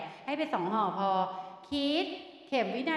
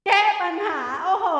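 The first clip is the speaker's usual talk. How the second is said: loud, higher-pitched quiz answer; real delighted shout; excited call-out; 8 semitones higher, 9 dB louder than usual. Real, not acted. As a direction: burst out with the solution. Quiz answer called out and cheered.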